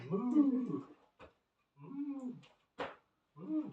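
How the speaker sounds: long, high-pitched imitated moo; cattle moo. Person sitting passively at Centrelink, long cattle-like mooing. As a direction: moo slowly like waiting cattle. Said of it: "like the cattle, just sit there".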